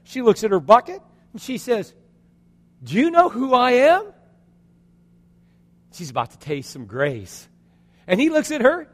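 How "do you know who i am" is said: high, loud indignant question; indignant challenge. Acted. Woman at the well to a thirsty stranger, indignant. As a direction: pitch it up, affronted.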